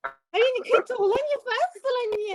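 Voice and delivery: high, loud, rapid shooing; exasperated. Real, not acted. Teacher shooing a pest out of class, exasperated. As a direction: scold and shoo them away.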